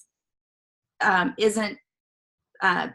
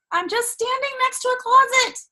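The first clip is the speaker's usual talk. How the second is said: high, loud comic outburst; hammed-up frantic exclamation; exasperated panic; 9 dB louder than usual. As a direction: cry out in disbelief at your own panic. Retelling panic beside the closet.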